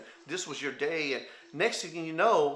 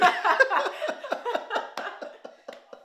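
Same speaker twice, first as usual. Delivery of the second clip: high laugh; 3 dB louder than usual. Real, not acted. Big laugh after punchline.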